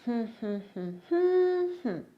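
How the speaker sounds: humming a word's rhythm, one long stressed hum; hummed stress pattern. Shown as a demonstration. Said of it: "humming and whistling".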